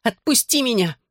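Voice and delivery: high, pleading cry to be released; narrated struggling protest; restrained cry. A narrator's reading. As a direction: cry out high, without full volume. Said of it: "кричала я".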